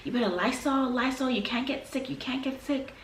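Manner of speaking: a mild imitation of a worried mother; mom's warning. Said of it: "She always used to say".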